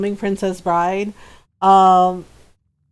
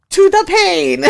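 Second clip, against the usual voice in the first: high, loud movie-quote delivery; hammed-up threat; mock defiant declaration; 7 semitones higher, 5 dB louder, slower than usual. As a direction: declare it dramatically like a duelling hero. Acts out film hero's correction.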